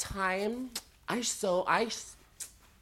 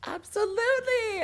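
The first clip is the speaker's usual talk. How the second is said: excited agreement; 6 dB louder than usual. Real, not acted. Emphatic agreement with co-host.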